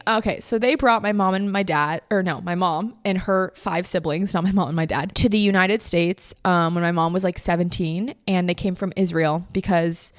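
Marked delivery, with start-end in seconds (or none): none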